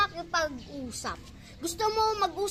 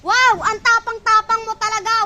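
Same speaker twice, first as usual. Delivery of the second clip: mocking; 4 semitones higher, 6 dB louder than usual. Acted quarrel, mocking the other.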